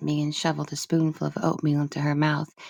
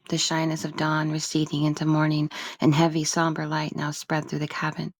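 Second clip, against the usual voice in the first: usual speaking voice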